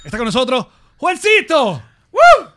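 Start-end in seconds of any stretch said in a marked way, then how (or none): 2.1-2.5 loud whooping cheer for the guest; big hype yell; excited woo. Real, not acted. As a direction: whoop him in. Hyping the guest's entrance.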